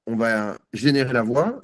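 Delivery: ordinary talk, nothing marked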